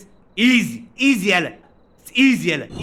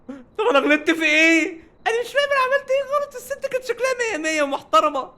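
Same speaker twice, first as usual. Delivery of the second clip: much higher, pleading disbelief; exasperated whine. Real, not acted. Gamer wailing to his boss about an unfair mistake. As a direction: cry out at the unfairness. Protests a mistake he can't understand.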